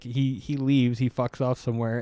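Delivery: normal talking voice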